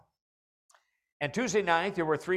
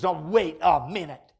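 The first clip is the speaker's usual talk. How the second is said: louder, higher protest, half acted; mock indignant objection; 5 semitones higher, 9 dB louder than usual. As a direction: act out a listener's outraged pushback. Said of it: "Somebody says".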